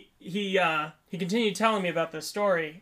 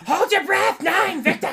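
high, very loud mock German commands; shouted German accent; 13 semitones higher, 9 dB louder, slower than usual. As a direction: bark it like a furious German machine. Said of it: "machine is made in Germany".

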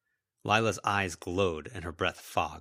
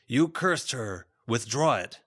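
slow start, firm higher command; stern order; 4 semitones higher, 4 dB louder, slower than usual. Acted. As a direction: order it undone like a teacher. Raymond orders Lila to withdraw curse.

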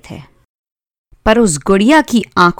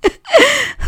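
high-pitched acted sobbing; hammed-up childish boo-hoo; bawling sobs. A narrator's reading. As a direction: sob like a wailing little girl. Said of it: "रोते भिलकते हुए".

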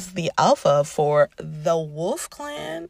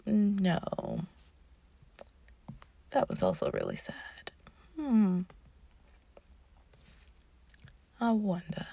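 musing to herself; 6 dB quieter than usual. Retold or imitated. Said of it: "I'm like".

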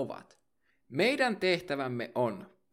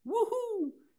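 whoop. Acted. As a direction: cheer like a fan at a concert. Cheer after each patron thanked.

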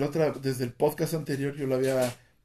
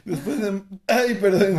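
laughing apology; 6 semitones higher, 6 dB louder than usual. Real, not acted. Laughing fit.

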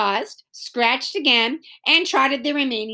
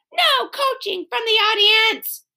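high, called-out command; ordering; 11 semitones higher, 3 dB louder than usual. A narrator's reading. Scooter the referee scolds the audience, ordering. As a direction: call out the rule as an order. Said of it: "ordered Scooter".

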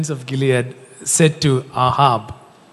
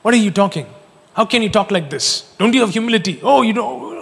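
higher-pitched, rising mock indignation; mock indignant scolding; 6 semitones higher, 4 dB louder than usual. Brother tells off the one facing Goliath, mock indignant. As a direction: scold like an affronted older brother.

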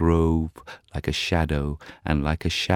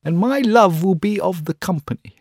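put-on young student voice; 14 semitones higher, 6 dB louder than usual. Student dreaming of his love.

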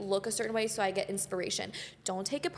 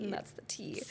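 breathy whispered repeat; hushed dramatic aside; whispered echo; 2 semitones lower, 9 dB quieter than usual. Acted. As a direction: whisper it back like a secret. Echoing whisper.

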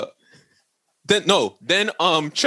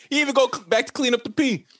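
usual speaking voice

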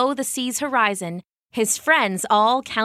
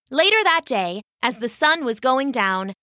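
ordinary delivery, nothing marked

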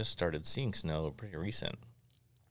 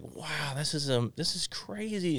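1 awed wonder; 8 semitones higher, slower than usual. Retold or imitated. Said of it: "And I was just like".

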